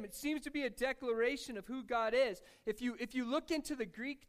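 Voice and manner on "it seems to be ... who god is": usual speaking voice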